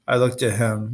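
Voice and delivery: ordinary delivery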